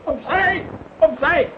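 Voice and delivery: commanding shout. Acted. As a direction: shove people aside with your voice. Pushing through, shouting to clear way.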